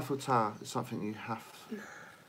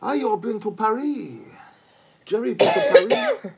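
teasing; 8 semitones higher, 7 dB louder than usual. Pretend. Teasing friend about Paris.

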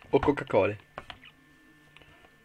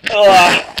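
loud gagging cry; 7 semitones higher, 15 dB louder than usual. Real, not acted. Loud outburst after spitting.

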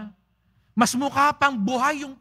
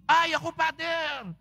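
a touch of eager parishioner, a bit higher; lightly voiced parishioner jumping at the outing; mock eager volunteer; 6 semitones higher, 4 dB quieter than usual. Priest mimics a parishioner eager for the outing, mock eager.

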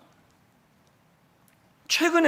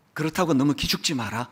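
soft, lower comfort from a father; lightly voiced father; 7 semitones lower, 4 dB quieter than usual. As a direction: gently tell her not to lose heart.

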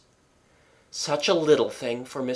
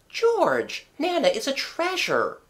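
far higher, reassuring; wife's voice; 14 semitones higher than usual. A narrator's reading. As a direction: defend Nana to the husband. Wife defends the dog.

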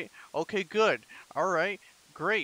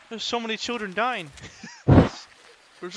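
usual speaking voice

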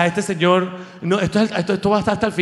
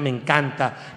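ordinary delivery

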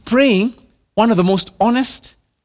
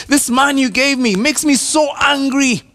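mock complaint; 7 semitones higher than usual. Adam-style complaint, voiced.

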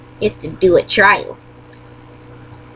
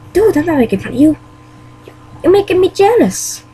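pitched-up, defensive insistence; protest, voice up high; 8 semitones higher than usual. Acted. Mario tells his friend he's only jealous, high and earnest.

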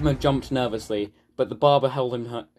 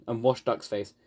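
plain reading voice

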